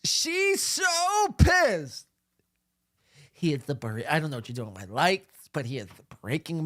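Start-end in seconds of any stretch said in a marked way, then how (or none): 0.0-2.1 excited shout. Real, not acted. Shouts gleefully about her anger.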